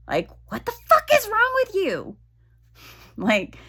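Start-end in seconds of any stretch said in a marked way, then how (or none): 0.1-2.2 much higher exasperated outburst; mock exasperation. Retelling her outburst at her own body, high mock exasperation. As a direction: squeal the frustration out, pitched way up. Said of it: "like, come on".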